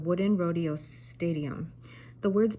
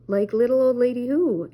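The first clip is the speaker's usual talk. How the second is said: Shaggy's line said loud and higher, a yodel-like lift; loud Shaggy question; 5 semitones higher, 8 dB louder than usual. A narrator's reading. Shaggy answers Daphne's knock-knock joke, loud and high. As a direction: punch it out, louder with a rising lift. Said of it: "asked Shaggy".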